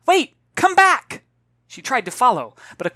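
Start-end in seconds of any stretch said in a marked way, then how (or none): 0.1-1.2 high, loud, urgent call. Acted. Girl calling after fleeing children, loud high shout. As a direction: shout after them before they vanish.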